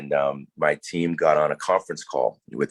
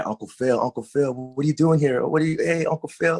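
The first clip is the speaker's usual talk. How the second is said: much higher, excited fan voice that keeps climbing; imitating surprised fans; 11 semitones higher than usual. Fans spotting the actor in a restaurant, excited surprise. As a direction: play a starstruck fan spotting him in public. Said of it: "they're just surprised".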